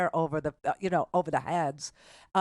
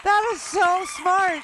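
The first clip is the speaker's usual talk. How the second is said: high, loud and slow, savouring "so smart"; thrilled host praising the caller, much higher and louder; excited praise; 12 semitones higher, 6 dB louder, slower than usual. Real, not acted. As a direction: gush with delight, drawn out. Host reacts to caller's analogy.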